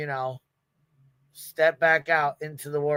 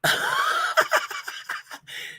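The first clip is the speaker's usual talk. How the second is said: squealing high-pitched laugh; helpless high laughter. Real, not acted. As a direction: let out a shrieking giggle. Laughing at chat.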